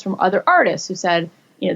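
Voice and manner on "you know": ordinary talk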